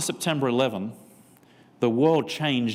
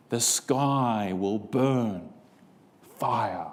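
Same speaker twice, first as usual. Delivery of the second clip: mystic; 3 semitones lower, much slower than usual. Retold or imitated. Coworker's mystic reading voice.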